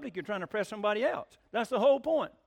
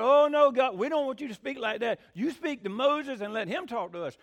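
opens with a loud, high cry of "oh no", then settles; pleading like scared people at the mountain; mock fearful plea; 5 semitones higher, 6 dB louder than usual. Israelites begging God to speak through Moses, mock fearful plea. Voicing frightened Israelites at Sinai.

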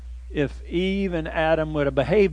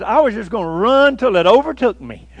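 louder earnest country answer; the runner explaining his plan, louder and earnest; simple runner; 4 semitones higher, 7 dB louder than usual. Retold or imitated. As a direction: say it plainly sincere. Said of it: "He said".

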